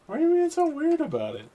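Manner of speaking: high, loud mock exasperation; exasperated outcry. Driver mock-complaining at the customer's weirdness, high and loud. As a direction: throw up your hands in a hammed-up whine.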